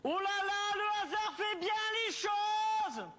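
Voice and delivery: very high, drawn-out shout; hollered exclamation. Acted. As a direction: holler it in mock delight, stretching the last word. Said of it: "me hurler dans les oreilles".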